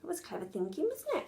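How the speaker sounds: quiet, tender aside; soft aside. Real, not acted. Narrator aside to child.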